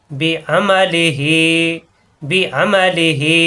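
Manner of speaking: বিআমালিহি with the মা drawn out slowly; stretched vowel. Shown as a demonstration. Teacher reciting বিআমালিহি slowly for students. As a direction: lengthen মা in বিআমালিহি. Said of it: "এক আলিফ টান".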